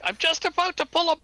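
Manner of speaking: hammed-up angry gamer; mock gamer outburst. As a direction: rant at whoever broke the connection. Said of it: "you could say things like".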